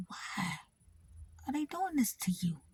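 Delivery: hushed whisper, as if sharing a secret; whispered quote. Retold or imitated. Retold quote from social worker.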